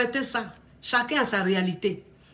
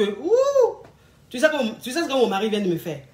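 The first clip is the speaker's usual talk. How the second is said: put-on bragging girlfriend. Friend bragging about her husband.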